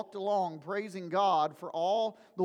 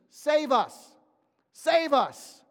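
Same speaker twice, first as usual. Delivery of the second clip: raised, higher plea for rescue; crying out; 5 semitones higher, 6 dB louder, much slower than usual. Acted. Preacher acts out the crowd's Hosanna, crying out. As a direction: cry it out twice like a plea. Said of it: "they also cried out".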